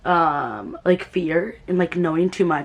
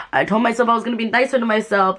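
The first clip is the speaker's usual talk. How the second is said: ordinary delivery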